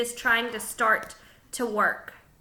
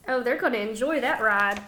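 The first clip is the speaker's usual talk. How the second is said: ordinary delivery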